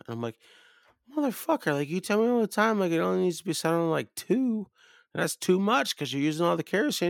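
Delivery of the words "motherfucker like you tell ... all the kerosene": high-pitched, exasperated protest; exasperated retort. Retold or imitated. Cabin guest to Pap about the kerosene, exasperated. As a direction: argue back in a strained high voice. Acting his argument over the heater.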